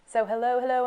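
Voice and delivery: ordinary talk, nothing marked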